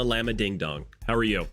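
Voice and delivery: usual speaking voice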